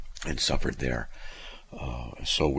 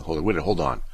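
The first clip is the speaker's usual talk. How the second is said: ordinary talk, nothing marked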